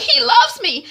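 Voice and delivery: high, loud lovelorn whine; pleading protest. Retold or imitated. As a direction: plead it to an angry mother. Daughter pleads with mother.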